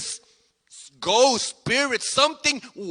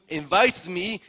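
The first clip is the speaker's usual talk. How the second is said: usual speaking voice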